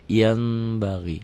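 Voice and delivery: YAN as "yam", slightly nasal, slow; nasal iklab. Shown as a demonstration. Teacher repeats the reading, nasal held m. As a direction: hum the m before BAGI. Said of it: "ada bindeng sedikit".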